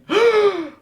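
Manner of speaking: sharp, very high gasp; shocked gasp. Retold or imitated. Vegapunk looking up in shock, sharp high gasp. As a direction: suck in air in shock. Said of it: "just being like".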